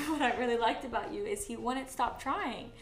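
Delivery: ordinary delivery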